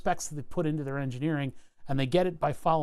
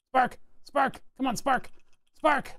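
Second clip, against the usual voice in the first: rising urgent coaxing; 8 semitones higher, slower than usual. Real, not acted. Urging a spark to appear.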